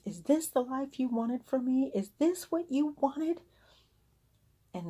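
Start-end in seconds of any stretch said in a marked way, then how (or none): 0.0-3.4 much higher pleading cry to God; retold tearful plea in prayer. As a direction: beg the Lord, close to tears. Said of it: "I started crying".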